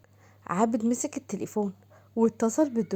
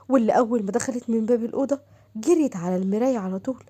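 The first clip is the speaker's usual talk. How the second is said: plain reading voice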